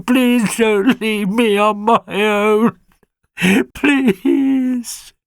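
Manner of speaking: put-on a frightened young hound begging not to be left; high puppy voice, slowing on the last plea; lost puppy. Alfie the lost puppy pleads.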